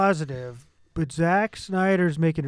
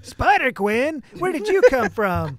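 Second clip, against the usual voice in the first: higher, louder, slow goofy shout; mock surprise; 7 semitones higher, 6 dB louder, slower than usual. Friend joining the Spider-Man mockery, loud goofy surprise. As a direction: shout it like a cartoon hero.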